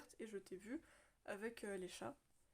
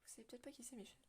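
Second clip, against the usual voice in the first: ordinary talk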